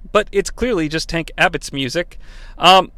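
usual speaking voice

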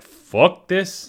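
loud, high, exasperated bail-out; fed up. Retold or imitated. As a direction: done with it, out of here. Orchestra quitting, voiced quote.

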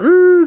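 high, loud "ooh" of a train; high train-whistle imitation; imitated train noise. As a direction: mimic a train with a high hoot.

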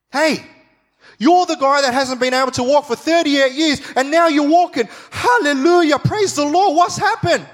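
high, loud, overjoyed shout of praise; a friend greeting the healed man with an amazed hallelujah; amazed celebration. Retold or imitated. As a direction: cheer like a friend seeing him walk. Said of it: "Should have been a normal reaction".

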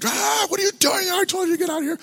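very high-pitched strained mock shout; raging heckler. Returning heckler ordering the preacher out, hammed-up raging shout. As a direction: imitate a furious man charging in yelling. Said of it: "same guy just comes barreling up".

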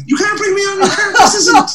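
high, laughing protest; mock-panicked plea to the MC. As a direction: squeak it out in laughing disbelief. Protesting the host's intro.

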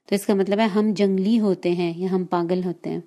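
normal talking voice

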